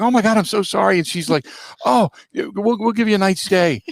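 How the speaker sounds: high-pitched, gushing apology; flustered apologetic clerk. Retold or imitated. Hotel clerk apologising to guest with welt, high and flustered.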